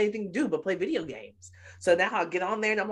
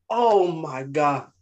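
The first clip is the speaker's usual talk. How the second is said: mortified groan; 6 dB louder, much slower than usual. Retold or imitated. Son mortified by mom.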